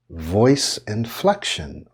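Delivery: "voice inflection" stretched slowly with a rise and fall; slow, modulated "voice inflection". Shown as a demonstration. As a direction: draw out "voice inflection" in a wave. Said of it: "so that's an example".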